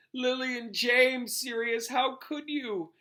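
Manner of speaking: high, slow mock sobbing plea; retold sobbing. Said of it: "They say he was sobbing".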